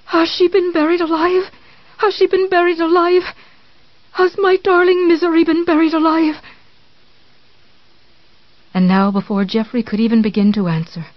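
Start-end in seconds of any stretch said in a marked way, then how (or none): 0.1-1.5 terrified. Acted. Repeated terrified question. 2.0-3.3 even more frantic repeat; terrified, higher. Acted. 4.1-6.4 high, anguished cry; terrified wail. Acted.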